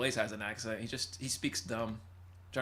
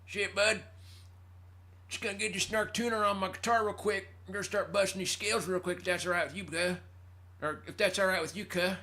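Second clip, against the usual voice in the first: Prison Randy; 8 semitones higher than usual. Acted. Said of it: "that's the Prison Randy voice".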